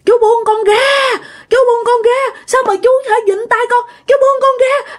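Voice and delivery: put-on high, panicked child's cry for help; terrified screaming child. Little girl shrieking at the ghost to release her, terrified cry. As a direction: scream at the stranger to let go. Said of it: "Nó la làng lên".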